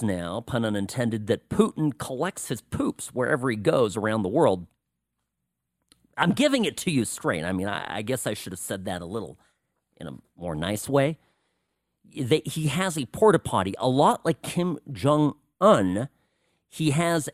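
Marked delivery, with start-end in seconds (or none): none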